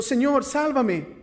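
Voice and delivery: high, loud, slow plea of a sinking man; cry for help. A narrator's reading. Peter sinking in the sea, loud high cry for help. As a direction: beg to be saved. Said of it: "dio voces diciendo".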